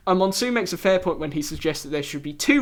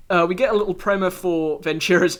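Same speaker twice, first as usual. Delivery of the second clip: usual speaking voice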